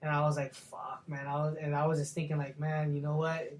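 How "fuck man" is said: breathy defeated whisper of a curse; whispered swear, all fight drained out; deflated whisper. Retold or imitated. Dejected after being told to cut.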